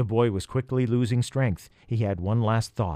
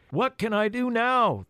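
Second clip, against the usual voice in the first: shouting over storm; 11 semitones higher than usual. Acted. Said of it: "the boy tried to shout above the noise".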